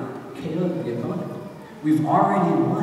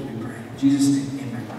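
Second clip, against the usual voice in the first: normal talking voice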